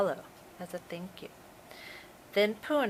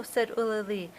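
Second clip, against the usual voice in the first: ordinary delivery, nothing marked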